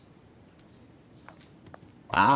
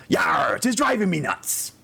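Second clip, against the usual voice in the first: pirate voice; 6 semitones higher than usual. Retold or imitated. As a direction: yell it like a pirate captain. Pirate punchline of joke.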